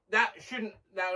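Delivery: usual speaking voice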